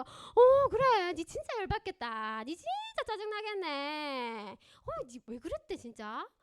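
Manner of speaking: high, exaggerated sympathetic friend; mock-tearful commiserating friend; gushing sympathy. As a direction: pour on the sympathy, drawn out and tearful. Friend empathizing, acted.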